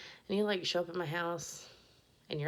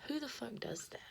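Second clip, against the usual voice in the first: higher, whispered outrage; whispered rant; 9 semitones higher, 4 dB quieter than usual. Real, not acted. Whispering outrage about strangers turning up unannounced. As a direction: hiss the question in disbelief.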